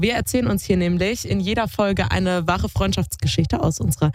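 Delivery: ordinary talk, nothing marked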